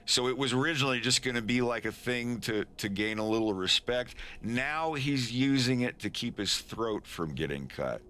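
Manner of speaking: ordinary delivery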